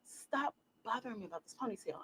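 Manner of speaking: very soft, fed-up retold warning; hushed warning. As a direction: say it under the voice, just for her. Retold scolding repeated, far quieter.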